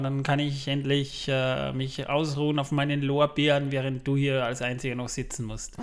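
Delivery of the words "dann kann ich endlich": ordinary delivery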